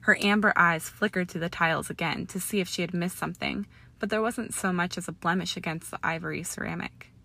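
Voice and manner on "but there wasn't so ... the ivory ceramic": plain reading voice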